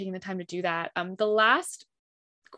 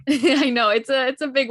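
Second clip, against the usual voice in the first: laughing through the words, a bit louder; 4 semitones higher, 7 dB louder than usual. Real, not acted. Host laughs at her own big question. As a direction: chuckle while admitting it's a big question.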